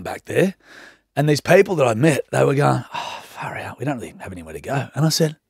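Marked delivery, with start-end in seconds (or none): 2.9-4.9 hushed, breathless whisper of new mates; whispered quote. Retold or imitated. New mates on the bus, whispered imitation. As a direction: whisper it like a sheepish confession.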